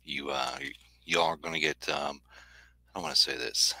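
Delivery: drowsy, soft morning voice; just woke up and still groggy; sleepy voice. Real, not acted. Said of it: "sleepy [name] voice this morning".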